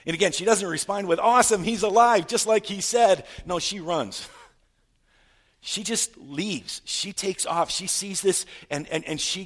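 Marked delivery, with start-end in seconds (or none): 1.2-3.2 loud, higher-pitched mock excitement; hammed-up triumphant reaction; imagined joyful cheer. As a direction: exclaim it as if thrilled by great news. Mock response Mary didn't give.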